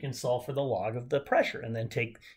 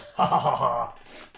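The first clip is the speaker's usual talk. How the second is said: loud run of ha-ha laughter; triumphant laugh; 4 dB louder than usual. Real, not acted. Teacher laughing after a victory cheer, triumphant laugh.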